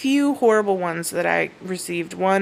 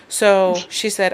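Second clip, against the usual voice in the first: ordinary talk, nothing marked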